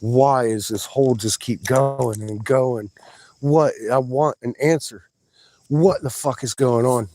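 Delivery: loud, exasperated demand for answers; retold frustration. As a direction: replay last night's frustrated questioning. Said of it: "I'm so frustrated".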